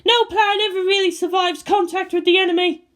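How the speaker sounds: character voice. Acted. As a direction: lift into a character voice.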